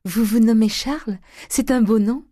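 loud, high-pitched burst of admiration; delighted exclamation. A narrator's reading. Eugénie learning her cousin's first name, exclaiming high and loud. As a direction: cry out, impulsively and high. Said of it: "s'écria Eugénie".

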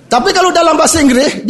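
normal talking voice

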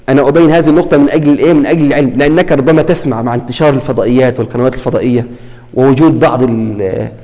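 usual speaking voice

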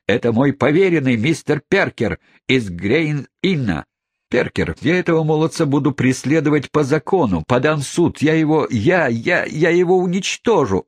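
put-on furious old gentleman stammering with anger; angry threat. A narrator's reading. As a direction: splutter the threats in rising rage. Wardle threatens Jingle furiously.

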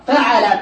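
فعل said fa-'a-la, middle vowel a; fatha on ain. Shown as a demonstration. Teacher listing verb patterns, فعل as fa'ala. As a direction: say فعل with an open a after the ain. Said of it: "له ثلاثة أوزان فقط".

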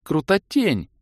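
high delighted cry; thrilled squeal. Acted. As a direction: gush with delight. Sorceress thrilled. (восторженный визг)

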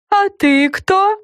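high, slow curious question; talking lollipop. A narrator's reading. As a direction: ask curiously. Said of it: "спросил Му у Френ".

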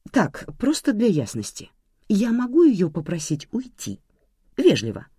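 measured, matter-of-fact query; businesslike. A narrator's reading. Nika asks whether she may evict the guest, businesslike. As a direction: check the facts, keeping it practical. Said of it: "уточнила по-деловому Ника".

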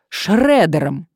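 loud, relishing retort; vindictive. A narrator's reading. Woman threatening her blackmailer with a shredder, vindictively. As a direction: deliver the word loudly with spite. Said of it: "мстительно выдала Мария".

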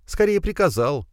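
high female mild grumble; grumbling girl. A narrator's reading. Alisa corrects Firs, grumbling. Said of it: "буркнула девушка".

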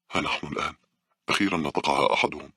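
plain reading voice

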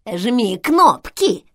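slow, loud creaky intercom voice; скрипучий голос. A narrator's reading. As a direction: creak it out slowly and loudly. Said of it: "скрипучий голос". (скрипучим голосом, громко и медленно)

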